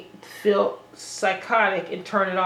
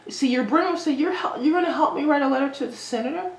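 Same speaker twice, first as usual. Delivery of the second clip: higher-pitched, rushed mock surprise; mock naive question; 6 semitones higher than usual. Mocking an imagined naive questioner.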